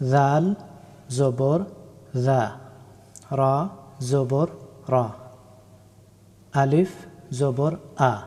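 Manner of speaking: letter by letter, naming জাল, রা, আলিফ with জবর, then the syllables জা, রা, আ; teacher spelling Arabic letters for learners; slow spelling out. Shown as a demonstration. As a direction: spell each letter and its fatha slowly. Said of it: "কয়েকটা উদাহরণ দেখে নেই".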